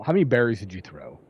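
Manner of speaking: normal talking voice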